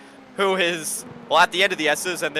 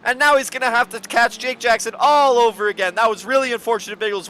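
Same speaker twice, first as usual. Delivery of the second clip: raised race call, gradually settling; excited play-by-play; 7 semitones higher, 6 dB louder than usual. Real, not acted. Commentator summing up the spin for co-commentator, excitement easing. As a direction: come down from the peak toward a handover.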